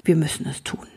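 barely voiced, falling away; hushed. The king quietly admits to Lukas it must be done. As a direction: confess it under your breath.

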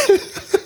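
high bursting laugh; burst of laughter. Real, not acted. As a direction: cracks up laughing. Laughs at own story.

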